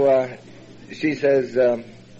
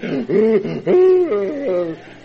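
sharp, higher gasp that tips into a laugh; 9 semitones higher, 5 dB louder than usual. Real, not acted. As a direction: catch your breath and laugh it off. Retelling breaking down in tears.

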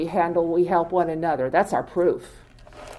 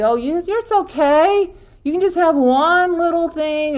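coaxing disease voice; 9 semitones higher, 4 dB louder than usual. Acted. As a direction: coax sweetly high, like temptation itself.

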